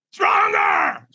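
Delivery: very high, strained scream; screamed command. Retold or imitated. Trainer screaming at a hesitant firewalker, high strained scream. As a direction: scream it as an all-out order. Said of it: "He screams at me".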